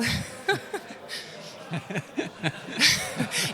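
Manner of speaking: real fit of laughter. Real, not acted. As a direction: break up laughing mid-sentence. Breaks into laughter mid-sentence. (riso espontâneo)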